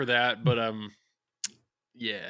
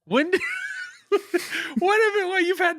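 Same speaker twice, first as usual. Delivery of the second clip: laughing. Real, not acted. Amazed at the soundboard voice.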